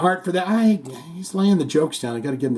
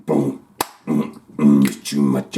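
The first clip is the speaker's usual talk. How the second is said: much lower, slow put-on voice; deep joke voice; 14 semitones lower than usual. Shown as a demonstration. Joking to viewers in a deep, slow mock voice. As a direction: drop way down for miss you much.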